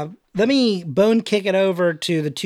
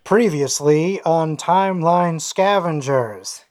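slow, deliberate "previously on" voice; drawn-out series recap intro; TV recap announcer; slower than usual. Pretend.

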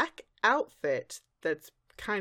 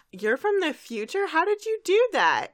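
higher and louder rising excitement; excited rambling; 10 semitones higher, 6 dB louder than usual. Retold or imitated. Present Twilight to future self, gushing excitedly. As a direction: gush with wonder. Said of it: "just keeps going on and on and rambling".

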